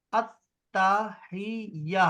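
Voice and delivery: التحیہ drawn out very slowly as "aahij"; very slow demo. Shown as a demonstration. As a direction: stretch التحیہ out for learners. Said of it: "التحیہ کافی ہے بس". (التحیہ بہت آہستہ، کھینچ کر)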